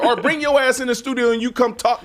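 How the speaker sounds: mock call-out. Real, not acted. Calling out a troll.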